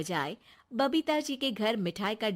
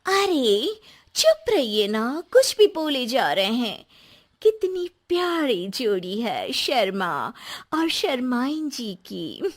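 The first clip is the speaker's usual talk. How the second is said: lightly acted scolding, louder, easing into praise; loud mock hush, then gushing compliment; playfully scolding hostess; 4 semitones higher, 8 dB louder than usual. Narrator voices Babita.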